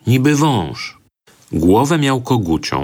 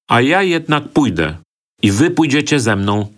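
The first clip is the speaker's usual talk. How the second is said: hot-headed shout; 6 semitones higher than usual. A narrator's reading. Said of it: "krzyknął zapalczywy Waluś".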